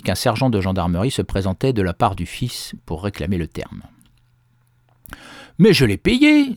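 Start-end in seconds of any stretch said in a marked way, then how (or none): 5.6-6.5 high, loud cry of protest; outraged exclaim. A narrator's reading. Farmer protests to the gendarme, high loud exclaim. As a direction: cry out that he already paid. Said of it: "s'écria Jean Gompère".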